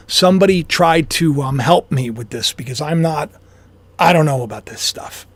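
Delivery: normal talking voice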